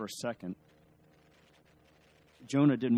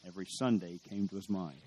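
ordinary talk

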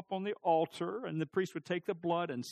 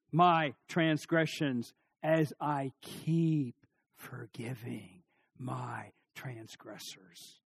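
deliberate recitation; 4 semitones lower, much slower than usual. Shown as a demonstration. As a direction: draw out each word, fading down. Prayer demo, slow continuing half.

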